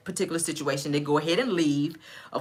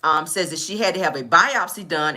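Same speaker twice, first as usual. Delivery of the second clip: ordinary talk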